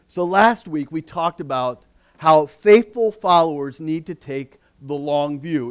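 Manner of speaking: ordinary talk, nothing marked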